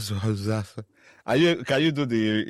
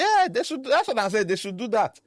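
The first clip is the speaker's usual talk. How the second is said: much higher, eager, insisting; high excited insistence; 14 semitones higher than usual. Real, not acted. Defending her joke to her co-host, gleeful and much higher. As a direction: push the idea with gleeful excitement.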